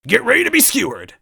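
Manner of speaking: high, loud villain screech; robot screech. Acted. Robot villain threatening the heroes, screeching. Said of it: "Yaradovik screeched".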